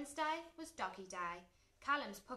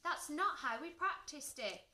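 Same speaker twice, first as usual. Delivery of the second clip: higher-pitched protest; dismayed cry; 5 semitones higher, 4 dB louder than usual. A narrator's reading. George to Sparky as the trick fails, dismayed. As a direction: protest with mild alarm. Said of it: "cried George".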